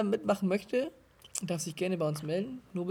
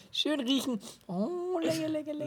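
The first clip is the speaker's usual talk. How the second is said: mock savoring; 9 semitones higher than usual. Sniffing and savoring the drink.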